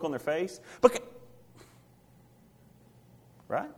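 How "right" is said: ordinary talk, nothing marked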